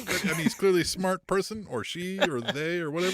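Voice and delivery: usual speaking voice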